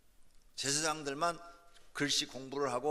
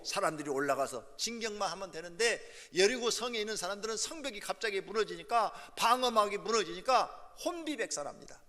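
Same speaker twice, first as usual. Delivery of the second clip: raised, driving sermon delivery; impassioned preaching; 8 semitones higher, 8 dB louder than usual. Real, not acted. Preacher tells congregation of Jericho's wall falling, impassioned preaching. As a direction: carry the congregation along at high pitch and volume. Preaches the battle at full force.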